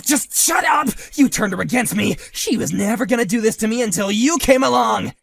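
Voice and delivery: very high, loud "Just shut up!" scream. Acted. Husband screaming at the rival over his wife, furious scream. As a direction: lose it completely. Escalating outburst.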